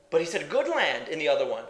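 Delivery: normal talking voice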